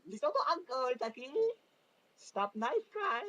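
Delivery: high, loud put-on voice; mock voicing. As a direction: shout it like a cartoonish kid. Mock voicing of Karate Kid.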